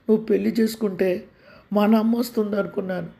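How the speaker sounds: put-on a small sick boy talking to daddy; young boy voice. Child Chandu questions father. (చిన్న పిల్లవాడి గొంతు)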